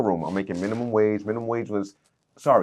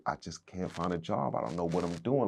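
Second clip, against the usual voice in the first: low, soft, defeated admission; subdued, replaying a low moment; retold breakdown; 3 semitones lower, 9 dB quieter than usual. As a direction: quietly recount being at a loss. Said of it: "but I broke down".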